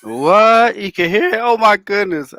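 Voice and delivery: loud, high-pitched disbelief; real shocked reaction. Real, not acted. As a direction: react aloud to a creepy ghost story. Listener shocked by the EVP story.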